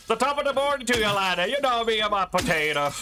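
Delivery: high, fast, hammed-up Irish accent; mock Irish accent. Mocking a viewer who thought she sounded Irish, in a hammed-up Irish accent. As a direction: ham up a silly stage-Irish voice. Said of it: "He thought I had an Irish accent".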